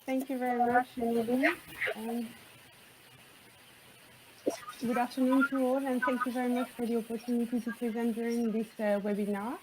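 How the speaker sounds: faint, far from the mic; low, faint speaking voice; barely audible. Real, not acted. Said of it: "your voice is very low".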